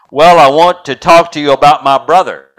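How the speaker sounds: put-on loud, impatient little girl pushing back; girl to pharmacist, answering back annoyed. A narrator's reading. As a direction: throw his annoyance right back at him. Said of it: "in the same annoyed voice".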